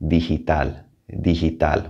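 digital in Spanish, G said like English H. Shown as a demonstration. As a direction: say digital as "dee-hee-tal". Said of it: "more like the English H".

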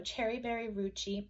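usual speaking voice

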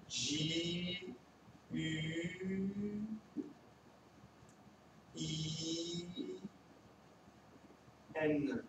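J U I N said letter by letter, quiet and slow; J, U, I, N, softly for the children; spelling out. Shown as a demonstration. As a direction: spell juin one letter at a time. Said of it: "spelling juin letter by letter".

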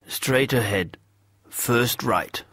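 put-on curt old villager brushing off a stranger; gruff old man. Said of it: "he said gruffly".

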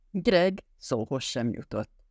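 usual speaking voice

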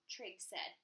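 ordinary delivery

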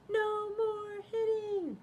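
very high, slow cheerful chant. Acted. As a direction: sing it out as a happy ending. High drawn-out character line.

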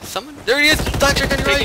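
high-pitched frantic warning; urgent callout. Real, not acted. Player warns teammate of enemy on the right, urgent shout.

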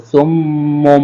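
সুম্মুম with the double m held and hummed through the nose; nasal ghunna. Shown as a demonstration. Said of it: "গুণনা করতে হবে।". (সুম্মুম গুন্নাহ করে)

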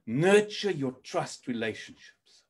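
ordinary talk

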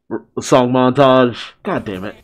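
ordinary talk, nothing marked

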